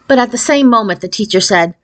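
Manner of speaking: plain reading voice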